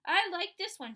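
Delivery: high, loud, smug reply. A narrator's reading. Sheila answering the boys smugly, high and loud. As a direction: act like you own the place. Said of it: "as if she owned the park".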